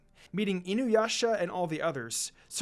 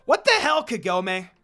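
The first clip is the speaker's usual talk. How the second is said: much higher and louder outraged yell; angry shout; 5 semitones higher, 9 dB louder than usual. Acted. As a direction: explode at Kagome after slamming into the ground. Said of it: "Inuyasha shouted".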